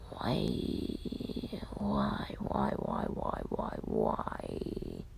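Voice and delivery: drawn-out, weary string of whys; low, fading plea of exasperation; exasperated lament. Acted. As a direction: moan it in hammed-up despair. Character laments facing villains alone.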